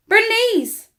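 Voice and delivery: high, loud joyful cry of a name; excited call. Acted. Girl greeting her sprouted squash friend, overjoyed cry.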